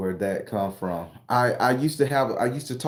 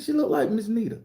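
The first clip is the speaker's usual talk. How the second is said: surprised answer; 13 semitones higher than usual. Retold or imitated. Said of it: "I said".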